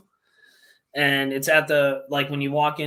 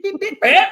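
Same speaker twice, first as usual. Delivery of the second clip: high, loud electronic beeps; mock beeping; 9 dB louder than usual. Joking about tagging koi, high loud beeps. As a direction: imitate a tracker beeping. Imitating a fishing tag device.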